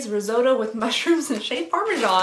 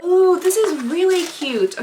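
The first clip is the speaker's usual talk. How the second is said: excited; 7 semitones higher, 8 dB louder, slower than usual. Real, not acted. Unboxing delight.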